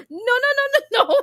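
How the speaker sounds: high, laughing protest; can't stop laughing while saying no; helpless laughter. Real, not acted. Laughing fit after joke.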